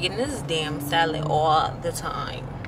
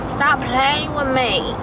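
slow, higher imitation of [name]'s accent; badly imitated [name] accent, drawn out; 4 semitones higher, slower than usual. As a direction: drawl it in a mock [name] accent. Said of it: "No, she don't sound like that".

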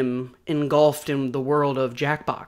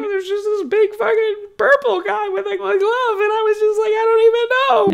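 hammed-up, higher and louder dramatic telling; comic alien kid recalling invasion; mock alien backstory; 7 semitones higher, 6 dB louder than usual. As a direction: ramp up into a frantic fake sob story. Joking alien origin story.

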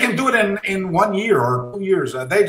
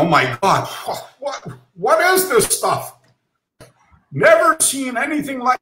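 higher, astonished crowd voice, slowing down; amazed onlookers; 9 semitones higher than usual. Retold or imitated. English students at a seminar seeing his karate, astonished and higher. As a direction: play the English students stunned by something new. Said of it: "everybody was going".

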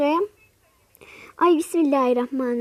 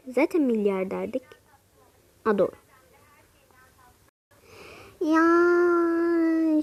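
ordinary talk

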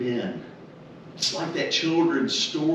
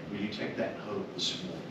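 normal talking voice

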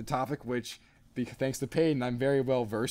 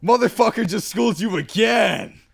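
loud, higher, slow gloating jeer; mocking taunt; 6 semitones higher, 8 dB louder than usual. Onlooker taunting the beaten guy, loud slow jeer. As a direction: crow it out slowly and loudly. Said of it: "And then the guy goes".

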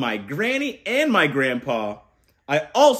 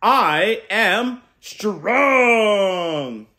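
very slow, loud, higher held call; proud shout; 4 semitones higher, 5 dB louder, much slower than usual. Shown as a demonstration. Uncle modelling a shouted affirmation for kids. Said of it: "we stand tall and shout".

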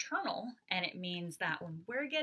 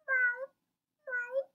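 very high, light meow; cat meow; 5 dB louder than usual. Shown as a demonstration. Teacher leading the class in cat meows. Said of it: "Can everyone say meow like a cat?".

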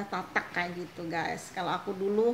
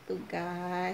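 normal talking voice

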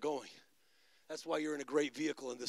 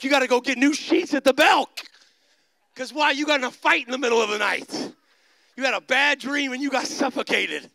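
loud, high, driving sermon; full-force preaching; 8 semitones higher, 13 dB louder than usual. Real, not acted. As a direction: preach it hard at the congregation.

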